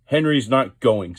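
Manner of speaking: a surly engine digging in its heels; rude. A narrator's reading. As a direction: read rudely and slowly. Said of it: "said Gordon rudely".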